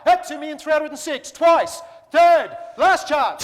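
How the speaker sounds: slow, loud, rising shout; auctioneer's final call. Real, not acted. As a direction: bellow the last call. Auctioneer closing the lot.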